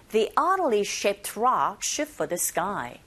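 slow model with "oddly" as AH-dly; teacher slowly models it for practice; slow drill line. Shown as a demonstration. Teacher drills the sentence slowly for listeners to repeat. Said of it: "listening two".

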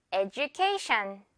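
"education" said eh-joo-KAY-shun, with a j sound, slowly; right j in education. Shown as a demonstration. Teacher showing students the native "education", j sound. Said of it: "One more time".

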